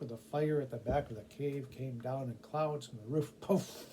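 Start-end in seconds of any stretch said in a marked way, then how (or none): none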